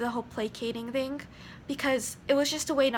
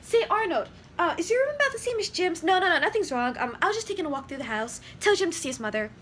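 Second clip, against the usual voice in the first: distractor; 4 semitones higher, 4 dB louder, faster than usual. Shown as a demonstration. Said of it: "If I was a distractor".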